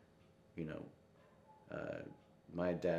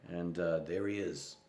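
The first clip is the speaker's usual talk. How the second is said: ordinary delivery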